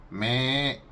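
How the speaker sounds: bleating me like a goat; a goat's short bleat; goat bleat impression. Shown as a demonstration. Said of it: "more like a bleat".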